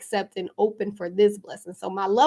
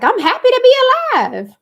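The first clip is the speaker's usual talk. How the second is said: high, loud mock cheer; 10 dB louder, faster than usual. Mocking how people act grateful, in a high, loud, hammed-up voice. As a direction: ham up being happy to be alive. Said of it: "but we be like".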